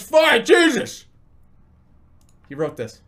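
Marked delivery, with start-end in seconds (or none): 0.0-1.0 much higher, louder, slow exasperated cry; exasperated shout. Retold or imitated. High friend giving in, loudly exasperated. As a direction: give in with a loud groan of annoyance. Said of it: "He goes".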